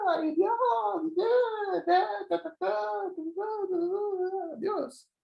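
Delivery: high, wailing mock lament to God; whiny crisis prayer. A believer in crisis whining to God, mock wailing. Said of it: "no habla así, habla así".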